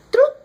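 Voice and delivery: short high "throop"; quick mouth-made whoosh of a throw; imitated tossing noise. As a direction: toss it off as a sound effect. Imitates the grenade being tossed.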